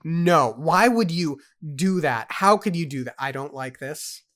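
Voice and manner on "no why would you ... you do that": loud, raised, incredulous questioning; real exasperation, voice pushed up; outraged protest. Real, not acted. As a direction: demand an answer from the author, appalled. Protests angrily at the book's twist.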